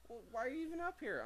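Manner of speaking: exasperated question. Real, not acted. Bickering with the person who walked in.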